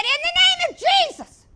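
high, loud holler of the name; a sudden desperate shout for help. Retold or imitated. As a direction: cry it out at the top of your voice. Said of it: "I hollered out".

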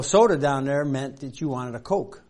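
ordinary talk, nothing marked

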